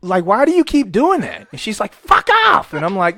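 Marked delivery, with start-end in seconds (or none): 0.2-1.5 loud, high retold demand; raised, pleading exasperation; exasperated yell. Retelling his exasperated question to her. 1.9-2.7 very high, loud shriek; explosive screeched imitation; furious mock scream. Imitating her screamed reply.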